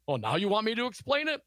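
high, sarcastic quoted question. A narrator's reading. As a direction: read it as a sarcastic retort. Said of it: "Costello replied sarcastically".

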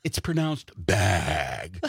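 slow condescending "It's pronounced bag"; correcting bag. Retold or imitated. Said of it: "they're like".

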